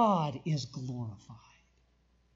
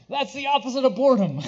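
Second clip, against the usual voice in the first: laughing it off, fast; amused laugh through the words; 4 semitones higher, 6 dB louder, faster than usual. Real, not acted. Laughs at the idea.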